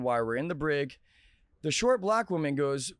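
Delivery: normal talking voice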